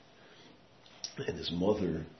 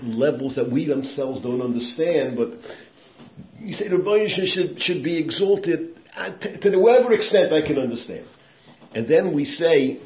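much higher and louder, slowed on key words; fervent preaching; 10 semitones higher, 13 dB louder than usual. Acted. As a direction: lift the crowd higher and higher. Preaching builds to a peak.

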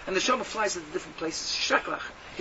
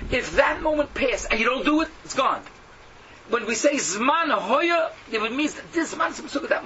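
emphatic preaching; 6 semitones higher, 4 dB louder than usual. Real, not acted.

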